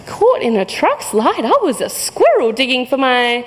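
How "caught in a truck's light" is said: normal talking voice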